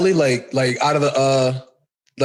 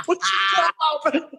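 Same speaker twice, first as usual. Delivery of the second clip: crying with laughter; squeezed out through laughter; laughing; 5 semitones higher, 3 dB quieter than usual. Real, not acted. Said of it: "I'm crying".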